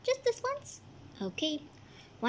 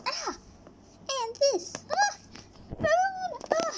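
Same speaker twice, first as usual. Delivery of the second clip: yelps. Acted. Hit by thrown things.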